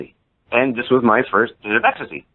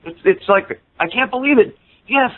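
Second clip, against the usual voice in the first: high-pitched retold elation; overjoyed; 10 semitones higher than usual. Fan getting tickets just before showtime, overjoyed. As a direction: act out thrilled disbelief.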